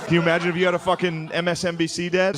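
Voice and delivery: usual speaking voice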